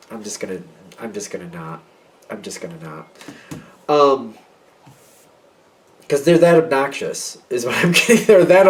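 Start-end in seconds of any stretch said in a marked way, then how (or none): 0.1-3.1 low, very soft opting-out; muted refusal. Acted. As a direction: back out under your breath, voice sinking. Imagined refusal to attend party.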